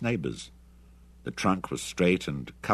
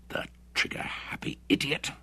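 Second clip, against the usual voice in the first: a furious whispered snarl; 8 semitones higher, 4 dB quieter than usual. A narrator's reading. Bruce curses Hendry for firing, whispered snarl. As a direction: spit it out in a whisper, seething. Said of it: "snarled Bruce".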